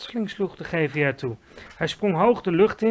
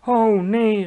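loud, higher wail of dismay; dismayed cry; 6 semitones higher, 11 dB louder than usual. A narrator's reading. Giant hearing what he caught, dismayed cry. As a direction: cry out at bad news. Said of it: "riep hij".

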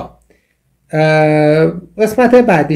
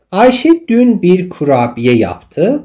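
slow reading; 5 semitones higher, 4 dB louder, much slower than usual. Shown as a demonstration. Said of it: "teacher reads example sentence slowly".

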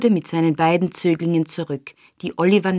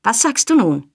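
pitched-up challenge; shrieking; 4 semitones higher, 3 dB louder than usual. A narrator's reading. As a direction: taunt with a mild shriek. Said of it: "kreischte Nancy".

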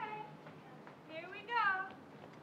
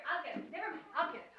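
ordinary talk

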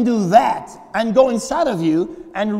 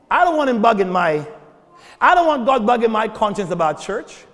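normal talking voice

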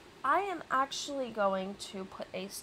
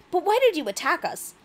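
higher, louder Nutcracker voice; Nutcracker's challenge; 8 semitones higher, 9 dB louder than usual. Acted. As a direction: demand an answer, high and loud. Nutcracker challenges the Rat King.

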